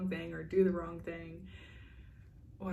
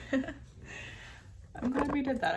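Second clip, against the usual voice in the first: laughing; 8 semitones higher, 5 dB louder, much slower than usual. Real, not acted. Just finished a body scan.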